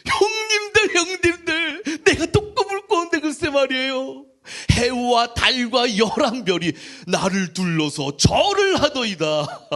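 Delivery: high, loud, drawn-out hammed-up call; gleeful boasting. Joseph calling his brothers to hear another dream, hammed-up glee. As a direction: play a naively thrilled little brother, stretching the words.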